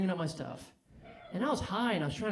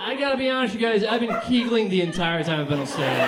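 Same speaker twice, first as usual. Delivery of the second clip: much louder, high and hyped throughout; loud crowd work; 4 semitones higher, 11 dB louder than usual. Real, not acted. Comic wrapping up, yelling at the crowd about his urethra. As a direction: shout it to the whole room with rising energy.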